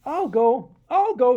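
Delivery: loud, high, keen little voice; eager volunteer. Acted. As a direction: jump at the chance. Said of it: "Sam volunteered".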